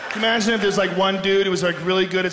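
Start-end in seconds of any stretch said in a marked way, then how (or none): none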